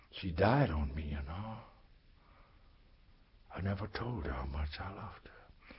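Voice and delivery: whispered, breath only, heartbroken; grieving whisper. Acted. Storyteller confesses to his dead teacher, whispering. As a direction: barely breathe the regret out. Grief-struck confession at her death.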